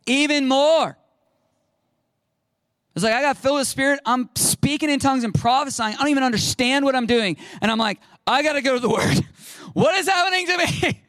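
loud, high, overwhelmed outburst; worked up to a high-pitched squeal; frantic excitement. Retold or imitated. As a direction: cry out like you're overcome and bewildered. Acts out frantic Spirit-filled excitement.

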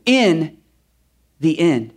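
ordinary delivery

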